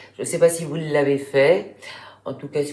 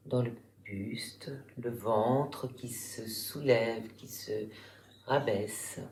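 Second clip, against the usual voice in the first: guided relaxation; 3 semitones lower, 8 dB quieter than usual. Real, not acted. Yoga teacher guiding listeners lying in Shavasana, calm meditation voice. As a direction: guide them calmly through the body, softer and lower, unhurried.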